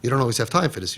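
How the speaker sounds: ordinary talk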